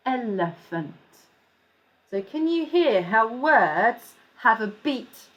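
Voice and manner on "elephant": ordinary delivery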